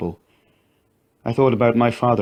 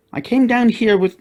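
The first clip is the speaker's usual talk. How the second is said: much higher, hurried little voice; child explaining; 13 semitones higher, 4 dB louder than usual. A narrator's reading. Katie tries to explain to mother, much higher child voice. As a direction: start to explain before cut off.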